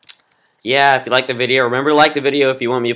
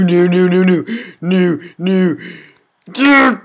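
ordinary talk

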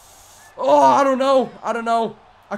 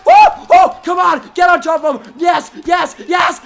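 real yelling, higher and louder; victory shouts; 6 semitones higher, 9 dB louder than usual. Real, not acted. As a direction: scream with joy at the win. Winning the fight, celebrating.

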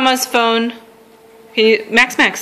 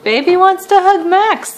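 put-on high sing-song baby voice; high baby talk; 9 semitones higher than usual. Mother repeats in baby voice.

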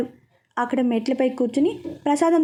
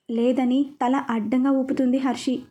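ordinary delivery, nothing marked

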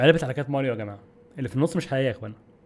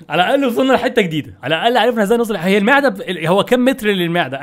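louder, higher excited talk; excited relief; 4 semitones higher, 6 dB louder than usual. Real, not acted. Gamer loudly excited to reach a new area. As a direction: burst out cheerfully about reaching new ground.

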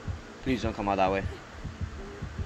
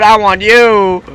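slow, drawn-out higher mock killer voice; creepy mock killer voice; 10 semitones higher, 14 dB louder, much slower than usual. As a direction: stretch it out like a killer taunting you. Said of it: "He said".

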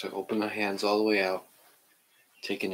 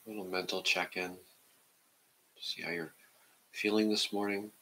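guided meditation; 2 semitones lower, 4 dB quieter than usual. Acted. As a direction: ease the class into stillness, half whispering. Leads a seated meditation.

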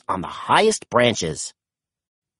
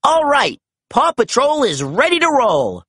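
excited rallying cry; 9 semitones higher than usual. A narrator's reading. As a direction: fire up the pups. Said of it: "Ryder exclaimed".

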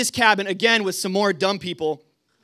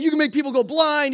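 high-pitched mock scolding; retold angry yell; 8 semitones higher, 4 dB louder than usual. Night watchman warns boys about the laser, imitated high scolding. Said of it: "if he just yells at us".

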